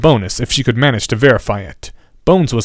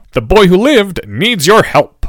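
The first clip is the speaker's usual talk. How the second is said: slow, higher and louder earnest plea; 7 semitones higher, 4 dB louder than usual. A narrator's reading. Fred pleads with Flume for Harry, earnest and higher. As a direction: press the old man to help.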